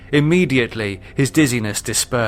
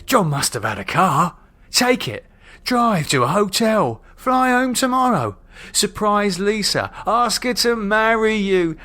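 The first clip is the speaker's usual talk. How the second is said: pleading with himself, climbing much higher; rising panic; 8 semitones higher than usual. Acted. Terrified David pleads with himself to run, rising panic. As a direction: talk yourself out of it, more desperate each line.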